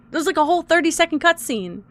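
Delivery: normal talking voice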